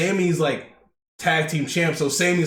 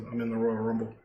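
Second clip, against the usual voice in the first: low, softer murmur of a retold line; 3 semitones lower, 7 dB quieter, faster than usual. Jay telling Roman he's entering the Rumble, murmured. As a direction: mutter it under the breath, defiant. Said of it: "he murmurs".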